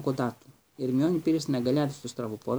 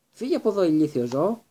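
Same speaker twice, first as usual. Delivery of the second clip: shouted at the cat; 5 semitones higher, 5 dB louder than usual. A narrator's reading. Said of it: "κράβγασε ο Ρον".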